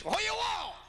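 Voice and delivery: high, blunt snapped question; grumpy mother-in-law. Retold or imitated. Mother-in-law answering son-in-law's call, high and curt. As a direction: answer the phone with a snap.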